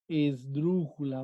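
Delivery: slow, drawn-out "esdrújula"; slow dictation. Shown as a demonstration. Teacher writing the answer for students, slow dictation. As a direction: stretch the word while writing it out. Teacher writes word on board.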